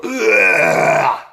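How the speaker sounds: loud, high ugh of revulsion; a giant's retching roar; disgusted roar. A narrator's reading. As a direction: bellow in disgust. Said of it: "roared the blood bottler".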